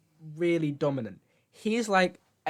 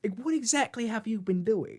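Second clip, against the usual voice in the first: higher-pitched mock interrogation; American guy questioning; 5 semitones higher, 3 dB quieter than usual. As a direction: lightly put on an incredulous questioner. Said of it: "this American guy was like".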